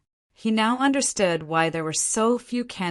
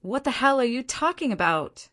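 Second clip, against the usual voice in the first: a touch of an angry yell, higher; mild angry yell; 7 semitones higher than usual. A narrator's reading. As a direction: snap back, lightly annoyed. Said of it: "He yelled with a dark expression".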